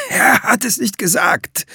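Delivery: high-pitched, frightened denial; frightened captive's stammer; panicked stammer. A narrator's reading. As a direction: plead ignorance in a high, desperate voice. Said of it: "stammelte der Dreifinger".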